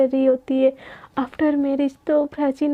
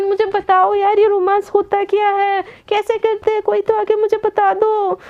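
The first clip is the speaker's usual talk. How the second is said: higher-pitched, dragged-out complaint; exasperated lament; 5 semitones higher than usual. Acted. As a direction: plead to the heavens for someone to explain. Exasperated lament about being unromantic. (झुंझलाकर दुहाई देते हुए)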